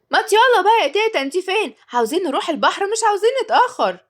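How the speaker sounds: put-on high, loud impatient boy's yell; shouting. A narrator's reading. Boy shouting for grandma to hurry to the beach, loud and high. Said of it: "صرخ إياد".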